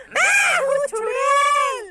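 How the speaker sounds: slow, drawn-out shrieking witch. Acted. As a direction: loom in and howl you are the witch. Mother pretends to be a witch.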